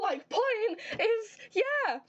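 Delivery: slow, strained outraged cry, then yelp; enraged squeal. Real, not acted. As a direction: let the outrage squeeze the voice up. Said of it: "I got so enraged".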